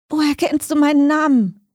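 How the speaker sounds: high, strained, frightened question; hoarse croak. A narrator's reading. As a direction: croak it out in alarm, pitched well up. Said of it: "nur noch ein heiseres Krächzen".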